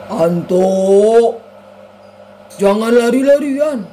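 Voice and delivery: loud, drawn-out mock-papa shout; imitated father's yell. Anto imitates papa scolding him for running, yelling. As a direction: holler it like a scolding dad. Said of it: "Papaku langsung teriak".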